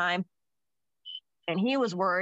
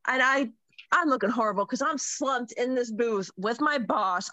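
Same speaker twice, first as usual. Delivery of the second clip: usual speaking voice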